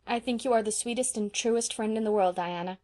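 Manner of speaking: ordinary delivery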